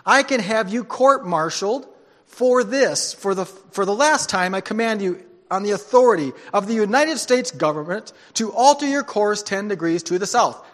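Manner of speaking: captain roaring his last order at the private; furious captain. Acted. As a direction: threaten him with full force. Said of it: "the captain growled back".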